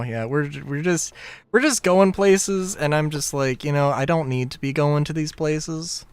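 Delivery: normal talking voice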